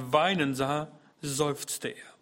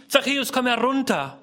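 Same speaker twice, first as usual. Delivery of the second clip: higher, louder call; 9 semitones higher, 7 dB louder than usual. Acted. Jesus calling Zachäus down, loud call. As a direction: call him down from the tree. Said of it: "Jesus rief".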